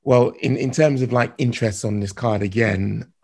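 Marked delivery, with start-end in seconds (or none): none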